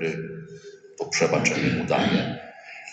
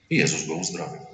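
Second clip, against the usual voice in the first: usual speaking voice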